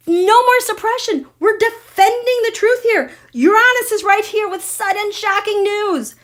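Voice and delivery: high-pitched, urgent excitement; fired up and pitched high; excited proclamation. Real, not acted. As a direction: announce breaking news in a high, thrilled voice. Excited proclamation of the chart reading.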